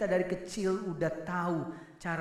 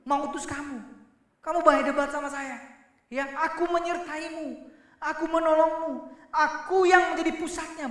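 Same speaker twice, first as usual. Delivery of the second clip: a lightly raised, insistent God speaking to Moses; preacher plays God gently scolding, higher pitched; voicing God; 8 semitones higher than usual. God tells Moses to simply obey, insistent. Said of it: "Tuhan ini cuman suruh sebenarnya begini".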